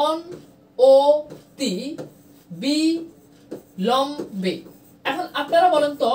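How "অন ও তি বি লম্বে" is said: অন ও তি বি লম্বে spoken as separate syllables with pauses. Shown as a demonstration. Teacher splitting a word for the class, syllable by syllable. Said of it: "তাহলে দেখেন আমি লিখলাম".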